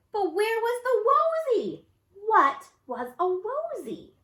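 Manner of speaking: high, slow and baffled; puzzled. A narrator's reading. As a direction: scratch your head out loud. Camilla puzzled.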